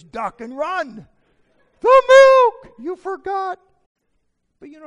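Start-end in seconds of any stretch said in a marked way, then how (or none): 0.0-1.1 loud, slow, high warning; mock alarm. Husband warning of wife's coming outburst, mock alarm. 1.8-3.6 very loud, high mock scream; imitating furious wife. Husband replaying wife's outburst over milk, mock-furious scream.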